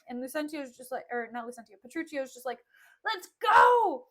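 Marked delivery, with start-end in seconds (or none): 3.0-4.1 high, loud holler; hammed-up yell to get going; mock impatient shout. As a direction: imitate an eager husband calling out. Acting Petruchio urging his bride.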